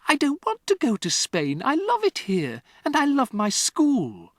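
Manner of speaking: put-on high, upset child's protest; pleading girl. Matilda protesting to her father, high and upset. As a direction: beg to stay. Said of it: "Matilda cried".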